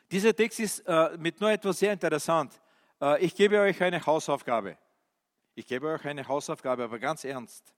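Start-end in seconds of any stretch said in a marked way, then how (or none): none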